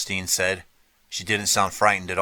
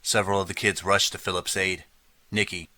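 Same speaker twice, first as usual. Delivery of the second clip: usual speaking voice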